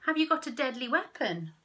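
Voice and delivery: loud, scornful anglerfish; sneering. Acted. Anglerfish mocking the squid, sneering. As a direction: look down on him. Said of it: "sneered an anglerfish".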